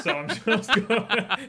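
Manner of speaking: laughing through the words; high laughing. Real, not acted. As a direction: giggle it out. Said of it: "Hey, that's the spirit".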